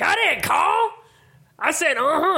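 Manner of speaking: very high, squawky mock protest; indignant old guy. Old guy denying his call at the table, high indignant imitation. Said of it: "He's like".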